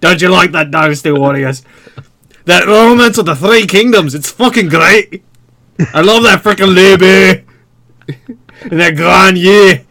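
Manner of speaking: loud, hammed-up Scottish accent; awkward Scottish accent. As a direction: sell it like a pushy Scottish salesman. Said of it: "a very awkward Scottish guy".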